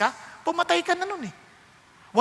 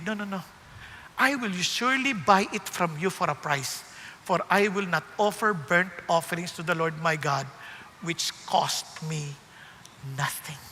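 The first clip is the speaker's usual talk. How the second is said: King David refusing a free offering, said softly; reverent David; 8 semitones lower, 5 dB quieter than usual. Retold or imitated. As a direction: speak it as a humble king's vow. David answering, retold reverently.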